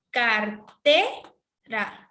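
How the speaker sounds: Car-TE-ra in separate syllables, swooping up on TE; stress on second syllable. Shown as a demonstration. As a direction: split Cartera and hit the middle syllable hard. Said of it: "lo hacemos de la siguiente manera".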